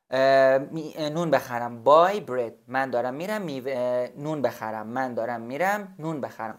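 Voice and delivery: usual speaking voice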